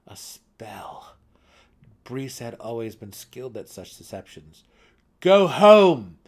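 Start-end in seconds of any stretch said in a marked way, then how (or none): 0.1-1.2 hushed unvoiced aside; whisper. A narrator's reading. Narrator whispers the discovery, hushed. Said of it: "narrator whispers". 5.2-6.2 high, loud shout; cried out. A narrator's reading. Drizzt shouts at his sister to go home. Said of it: "Drizzt cried at her".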